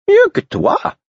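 sincère, voix aiguë du fou; sincere, in the Fool's high voice. Acted. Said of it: "répondit-il avec sincérité".

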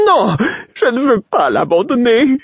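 high, loud, anguished refusal; cling to the dead king and wail it; the jester refusing to abandon his king, in despair; distraught fool. Acted. Grieving fool refuses to leave.

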